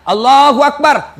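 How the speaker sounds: slow, loud drawn-out takbir; takbir call. Shown as a demonstration. Preacher demonstrating the first takbir, slow and loud. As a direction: call Allahu Akbar slowly like an imam. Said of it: "Takbir ketiga".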